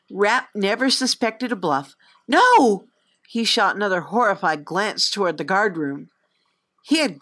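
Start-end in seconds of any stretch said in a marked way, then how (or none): 2.3-2.9 loud high cry of no; sudden panicked no; alarmed protest. Acted. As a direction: blurt it out in horror. Horrified guard protests.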